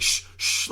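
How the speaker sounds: imitated sharp hushing; unvoiced "shh" to silence her; retold urgent shush. Imitates man hushing the girl.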